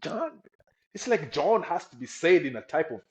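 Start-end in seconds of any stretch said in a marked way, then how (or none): 0.0-0.4 imitating a confused listener, pitched up; puzzled repeat. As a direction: echo the name back, baffled.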